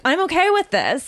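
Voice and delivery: high-pitched imitation of an overeager dad; announcing it out loud. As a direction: proclaim acceptance a bit too pointedly. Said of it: "he almost had to say it out loud".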